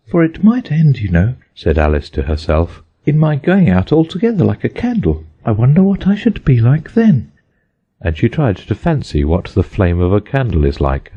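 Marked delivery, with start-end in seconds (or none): 0.1-1.3 put-on high, uneasy girl's voice; nervous Alice. A narrator's reading. Alice fearing she'll vanish, high and nervous. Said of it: "She felt a little nervous". 3.0-7.3 put-on high, uneasy girl's voice; nervous Alice. A narrator's reading. Alice fearing she'll vanish, high and nervous. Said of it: "She felt a little nervous".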